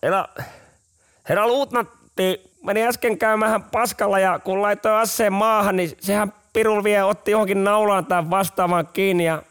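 a sheepish soldier reporting to his sergeant. Retold or imitated. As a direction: explain the mishap sheepishly.

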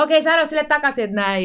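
retold shout from upstairs. Daughter yelling a reply down to mum, high and loud. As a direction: shout it back from the top of the stairs. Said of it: "ja sitten se huutaa".

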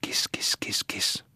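very soft whispered cat call; coaxing whisper. A narrator's reading. Juntus creeps up on the cat, coaxing whisper. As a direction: whisper it to lure a cat closer. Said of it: "alkoi hän sitä lähestyä pyydellen".

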